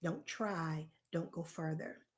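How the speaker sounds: understated, hushed voice of self-doubt; little inner voice. Acted. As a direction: quietly voice the doubt in someone's head. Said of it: "that actually speaks to you and says".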